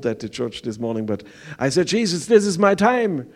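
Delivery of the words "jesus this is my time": "Jesus, this is my time." said higher and louder; loud proclaimed prayer. Retold or imitated. Repeats prayer, now proclaimed.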